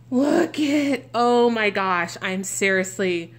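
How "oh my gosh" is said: ordinary talk, nothing marked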